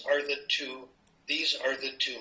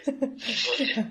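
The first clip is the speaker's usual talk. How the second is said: a laughing okay, pitched up high; 10 semitones higher than usual. Real, not acted. Laughing to Roz after the German line. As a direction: laugh through the word.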